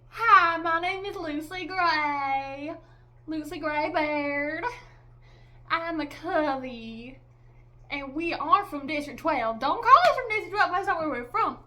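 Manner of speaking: mocking, drawn-out country accent, loud; hammed-up parody of a character's accent; Lucy Gray. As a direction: exaggerate her accent with long stretched vowels. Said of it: "I just didn't like her, her accent".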